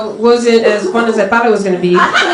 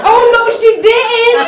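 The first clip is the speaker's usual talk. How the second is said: high, slow sassy exclamation; 12 semitones higher, 3 dB louder than usual. Acted. Talking pussy gives sassy attitude. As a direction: sass it up like a diva.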